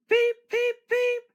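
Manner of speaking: very high, loud whistling peeps; all engines toot together; chorus of whistles. A narrator's reading. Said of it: "they all whistled".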